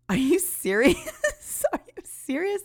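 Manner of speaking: high, laughing disbelief; giddy incredulous laugh. Retold or imitated. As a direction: laugh out the question in happy shock. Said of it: "And I'm laughing".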